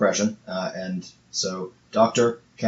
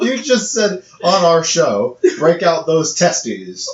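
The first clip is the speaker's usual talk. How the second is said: higher, louder, slow mock scolding; mock outrage; 7 semitones higher, 7 dB louder, slower than usual. Real, not acted. She repeats her cohost's line in mock outrage. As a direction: throw his words back at him, scandalised.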